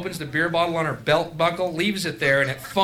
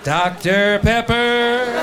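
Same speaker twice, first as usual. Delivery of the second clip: high, very drawn-out slogan delivery; exaggerated slow soda-ad call; mock ad slogan; 6 semitones higher, 4 dB louder, much slower than usual. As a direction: stretch the brand name out like a commercial.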